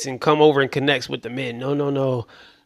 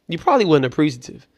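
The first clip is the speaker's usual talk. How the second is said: usual speaking voice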